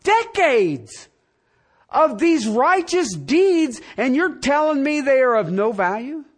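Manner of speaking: Nicodemus protesting. Retold or imitated. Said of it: "but Nicodemus says".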